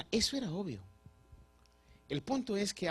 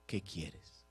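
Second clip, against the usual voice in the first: low, very soft, gentle question; Jesus asking softly; 10 semitones lower, 13 dB quieter than usual. Acted. Jesus to the blind man, soft and low. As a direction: ask it quietly, almost to himself. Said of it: "Jesús le dice".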